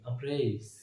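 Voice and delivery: soft, gentle अमरेज; said softly. Shown as a demonstration. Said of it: "softly बोलेंगे हर word को". (अमरेज को धीरे और नरमी से बोलें)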